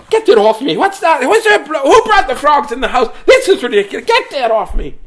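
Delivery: shrieking high and loud, frantic grandma; panicked grandma. Retold or imitated. Grandma shrieking as a frog lands on her head. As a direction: scream like a frog just hit your head. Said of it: "She'd be like".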